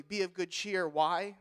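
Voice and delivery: ordinary delivery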